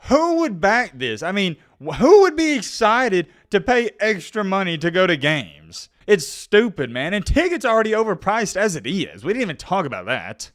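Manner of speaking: high-pitched, emphatic indignation; indignant disbelief. Real, not acted. Ranting to viewers about fans paying extra, indignant disbelief. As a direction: argue it in exasperated disbelief.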